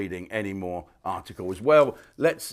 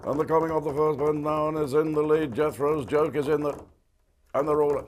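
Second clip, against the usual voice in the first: a raised, rushed race-call voice; racing commentator; 5 semitones higher than usual. Retold or imitated. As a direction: call the race like a track announcer.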